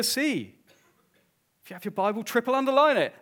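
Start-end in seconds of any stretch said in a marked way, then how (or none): 1.6-3.1 loud, high push on every word; emphatic urging. Real, not acted. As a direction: drive the instruction home with full force. Preacher urging congregation.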